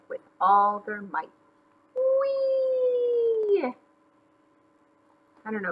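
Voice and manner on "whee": very high, sustained "whee"; gleeful squeal. Acted. Bunnies soaring their kite, gleeful squeal. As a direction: hold a long high squeal.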